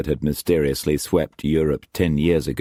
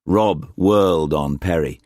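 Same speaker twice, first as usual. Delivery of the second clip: ordinary delivery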